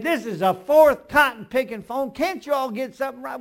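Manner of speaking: loud, exasperated outburst at the clerk; fed-up customer yelling, pitch way up; angry complaint. Retold or imitated. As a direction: huff it out like you've had it with them. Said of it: "I was mad".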